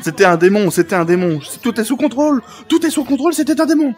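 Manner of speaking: panicked shouting. Acted. Zenitsu insisting to the group it was a demon, panicked shout. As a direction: shout it over and over, losing control.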